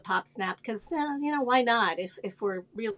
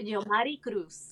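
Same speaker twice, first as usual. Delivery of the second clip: name said in Spanish, tapped r in Mary; Spanish accent. Shown as a demonstration. Guest says her name properly for the host, in a Spanish accent. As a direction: give your name its proper Spanish pronunciation. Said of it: "say it with the accent".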